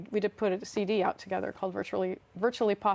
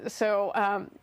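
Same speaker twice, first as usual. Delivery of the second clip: usual speaking voice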